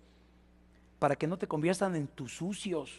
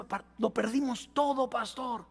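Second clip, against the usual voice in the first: higher-pitched mock lament to the pastor; distressed complaint; 8 semitones higher than usual. Church members come to their pastor after losing everything, mock distressed complaint. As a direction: imitate a congregant bewailing a loss.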